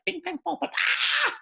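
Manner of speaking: high, wild gibberish screeching; mock screaming. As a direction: squeal nonsense syllables like a screaming queen. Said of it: "Just start screaming and cackling and carrying".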